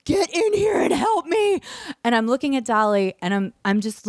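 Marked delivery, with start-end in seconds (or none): 0.0-1.6 high-pitched mock scream for help; retold scream. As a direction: plead in a high, strained cry without full volume. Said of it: "I scream at the sky".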